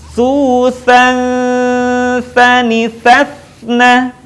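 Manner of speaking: slow chant with "Susan" held long; held nasal. Shown as a demonstration. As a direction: hold the closing hum of "Susan" into the s. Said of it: "tahan suaranya". ("Susan" ditahan dengung, menutup ke huruf s)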